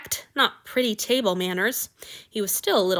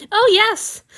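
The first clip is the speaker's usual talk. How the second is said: high, loud, thrilled burst; light up with joy; eager delight; 13 semitones higher, 9 dB louder than usual. Acted. Boy thrilled his lord will drink from him, high eager burst.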